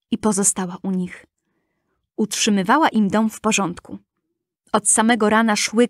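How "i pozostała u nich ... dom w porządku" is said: usual speaking voice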